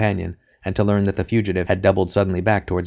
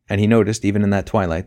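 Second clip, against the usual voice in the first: usual speaking voice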